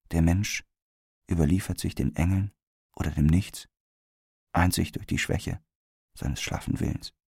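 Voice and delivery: soft, fading last words; quiet deathbed utterance, trailing away to breath; dying murmur. A narrator's reading. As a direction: murmur it faintly, sinking almost to a whisper. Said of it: "ein leises Murmeln von ihren Lippen".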